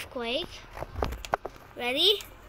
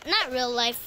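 usual speaking voice

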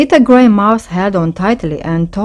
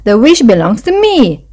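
a touch cross, higher and louder; 5 semitones higher, 5 dB louder than usual. A narrator's reading. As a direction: stake your claim in a mild storybook huff. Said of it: "Little Brown Mouse replied crossly".

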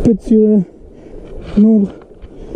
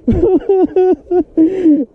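high breathless laugh; excited laugh; 9 semitones higher than usual. Real, not acted. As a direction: laugh with joy at the fish. Laughs at the catch.

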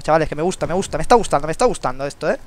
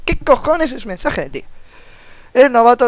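baffled; 7 semitones higher, 7 dB louder than usual. Real, not acted. Confused by game menu.

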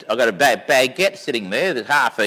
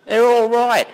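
correcting; 8 semitones higher, 4 dB louder than usual. Real, not acted. Correcting left to right on phone.

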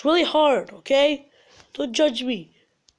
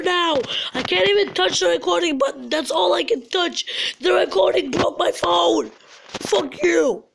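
high-pitched, upset tirade; raised, whining angry outburst; frustrated rant; 6 semitones higher than usual. Real, not acted. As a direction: rage at the broken phone. Rants at broken recording.

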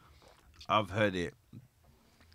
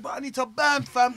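high, louder whiny plea; pleading; 11 semitones higher, 5 dB louder than usual. Retold or imitated. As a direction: beg for it like a kid. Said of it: "I said".

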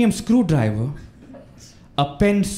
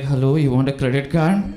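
disguised caller voice; 5 semitones lower than usual. Retold or imitated. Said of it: "with a slightly different voice".

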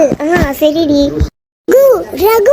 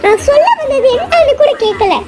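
hurt outburst; 8 semitones higher than usual. Acted. Hurt wife reveals twins.

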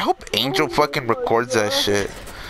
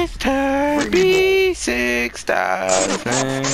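very high, slow put-on voice; stretched-out squeaky character voice; Dreamy Bow voice; 14 semitones higher, much slower than usual. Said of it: "practicing your Dreamy Bow impression".

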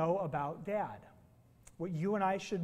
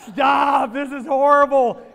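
loud mock protest from the kids, higher; 5 semitones higher, 9 dB louder, much slower than usual. Kids begging dad to stop the jokes, loud mock protest. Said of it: "my kids are like".

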